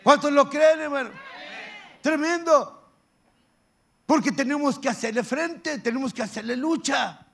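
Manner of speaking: loud, high rallying call; fervent pulpit exhortation; full-force preaching. Acted. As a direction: rouse the congregation to fight. Rallies the congregation.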